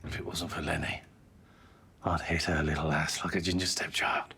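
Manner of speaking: hushed under-the-breath threat; whispered aside. Real, not acted.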